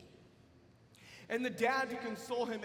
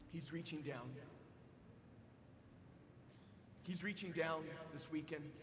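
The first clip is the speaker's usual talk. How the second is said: soft invitation; 8 semitones lower, 9 dB quieter than usual. Acted. Preacher inviting the congregation to confession, hushed and tender. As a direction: draw them in gently, almost prayerful.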